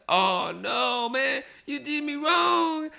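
slow, rising, high mock-Jamaican complaint; a hammed-up Jamaican accent complaint. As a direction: whine it like a wronged Jamaican guy.

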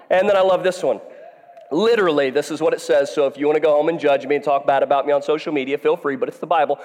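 preacher hamming up Elijah's mockery of the prophets; laughing full-force preaching. Real, not acted. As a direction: shout and laugh through the taunts.

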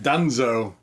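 normal talking voice

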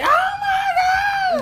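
a very high, slow, crying shout; shrieking auntie. Retold or imitated. Auntie calling after hearing the news, crying shriek. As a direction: wail it like the auntie on the phone.

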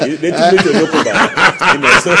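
bursting with laughter; can barely speak for laughing; laughing while talking. Real, not acted. Host cracks up over letter.

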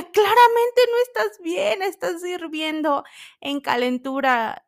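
high, loud disbelieving outburst; exasperated exclaiming. Retold or imitated. Telling the feverish girl she's not fine, in a high loud exasperated outburst.

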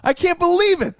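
high-pitched gushing; overjoyed, hammed-up anticipation; full of excitement. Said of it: "I'm, like, full of excitement".

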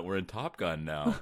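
usual speaking voice

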